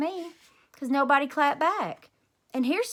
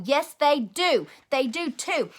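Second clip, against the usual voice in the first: louder, slow, foot-stamping protest; stubborn insistence; 3 semitones higher, 5 dB louder, slower than usual. A narrator's reading. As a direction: insist hotly against the bully. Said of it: "I stamped my foot at him".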